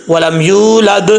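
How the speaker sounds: ولم یولد slowly with an echo on the dal; qalqalah demo. Shown as a demonstration. Teacher gives pupils a qalqalah example. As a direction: bounce the final dal of یولد. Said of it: "یہ قلقلہ ہے".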